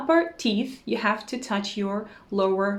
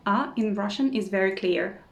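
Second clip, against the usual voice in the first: ordinary delivery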